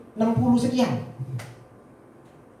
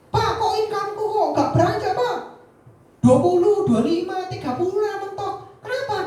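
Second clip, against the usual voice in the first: high complaining agent voice; a stuck sales agent whining high to the boss; whiny agent; 9 semitones higher than usual. Acted. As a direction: whine to the boss about a stuck income.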